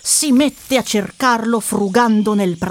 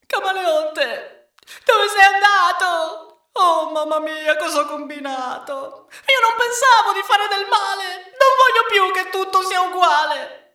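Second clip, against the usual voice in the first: desperate wailing; 11 semitones higher than usual. A narrator's reading. Tommaso regrets his wish and calls the chameleon, despairing wail. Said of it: "Piange a dirotto, è disperato".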